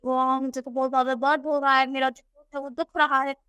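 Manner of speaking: ordinary delivery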